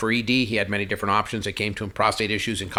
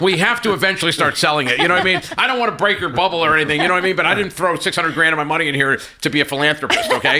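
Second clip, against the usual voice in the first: louder, higher, emphatic reality check; retold exasperation, pitched up and punchy; exasperated businessman; 5 semitones higher, 4 dB louder than usual. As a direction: spell it out loudly to a naive partner. Acted pep talk to naive partner.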